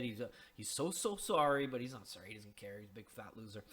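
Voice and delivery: ordinary delivery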